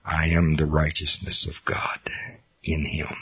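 hushed, almost afraid to say it; awed whisper. Retold or imitated. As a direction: whisper it, barely daring. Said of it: "the first time that I ever actually said out loud".

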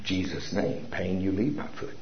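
soft, calm command to the foot; quiet command. Real, not acted. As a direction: speak it low and steady, no screaming. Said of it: "not how much you scream".

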